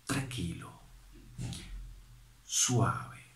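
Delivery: softly, ending in a whisper; gentle and soft. Shown as a demonstration. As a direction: show meekness with a hushed voice. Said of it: "¿Cómo es la mansedumbre?".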